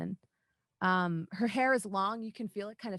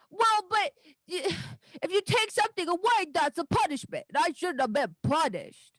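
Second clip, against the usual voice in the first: put-on high, loud childish protest; 9 semitones higher, 6 dB louder than usual. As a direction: insist like a kid who's been wronged. Daisy protests the unfairness.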